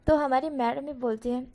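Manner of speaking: plain reading voice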